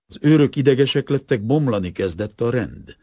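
usual speaking voice

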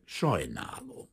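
cool reply. A narrator's reading. Detective turning down the banker, cool and slow. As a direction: answer coolly, softly, drawn out. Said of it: "felelte hűvösen".

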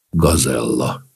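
low, soft, amorous murmur; lovingly. A narrator's reading. Count courting the dancer, lovingly. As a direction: coo it tenderly, pitched low. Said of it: "mondta Antas szerelmesen".

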